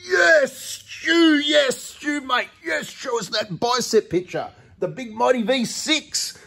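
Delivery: put-on high, loud gushing fan-boy squeal, slow at the end; mocking fan voice. V six owners fawning over Stu, high mocking squeal. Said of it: "the V six owners like to go".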